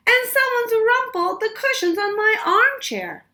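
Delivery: a high, indignant mum shouting; yelled. A narrator's reading. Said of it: "yelled Missus Chan".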